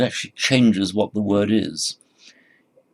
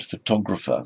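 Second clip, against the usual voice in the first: photographer stressed on TOG, the second syllable; pho-TOG-rapher, second syllable strong. Shown as a demonstration. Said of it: "stress is on the second syllable".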